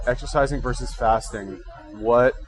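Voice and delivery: usual speaking voice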